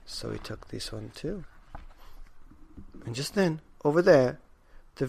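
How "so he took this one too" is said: ordinary delivery, nothing marked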